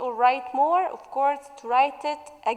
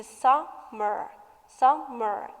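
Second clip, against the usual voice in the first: "summer" split as sum, mer; two separate beats on "summer"; syllable by syllable; slower than usual. Shown as a demonstration. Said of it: "It has two syllables".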